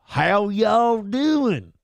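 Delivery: slow Southern drawl. Retold or imitated. Comedian preferring the South, slow Southern drawl. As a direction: drawl it out slowly, Southern style. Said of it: "I would take".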